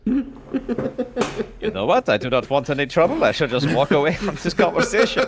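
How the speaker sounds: laughing. Real, not acted. Player laughing while dodging the ox.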